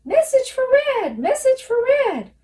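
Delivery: very high, loud gnome screech. Acted. Gnome running off to Red, high loud screech. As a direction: screech it as you scamper off. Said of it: "scurried off screeching".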